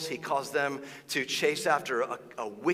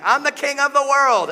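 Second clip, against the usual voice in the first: much higher and louder cry of victory; triumphant boast; 12 semitones higher, 11 dB louder than usual. Retold or imitated. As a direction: crow it from the top of the world. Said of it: "he's like".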